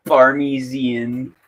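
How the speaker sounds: very slow drawn-out Parmesan; careful Parmesan. Shown as a demonstration. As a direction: stretch out every syllable of "Parmesan". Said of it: "Pretend the H isn't there".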